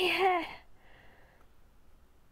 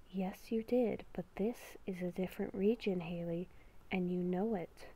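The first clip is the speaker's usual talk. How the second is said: beast within voice; 5 semitones lower, 11 dB quieter than usual. Acted. Inner beast whispering a warning to Haley, hushed and ominous. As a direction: whisper like a creature living inside her head.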